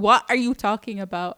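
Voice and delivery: impatient second asking; exasperated. Retold or imitated. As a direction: throw the question back hard. Repeats question, exasperated.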